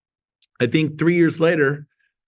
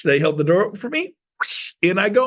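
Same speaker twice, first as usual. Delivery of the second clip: ordinary delivery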